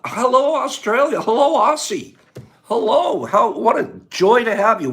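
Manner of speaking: thrilled to have you here; excited greeting. Real, not acted. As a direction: call out a welcome to a far-off guest. Greets a surprise viewer from Australia.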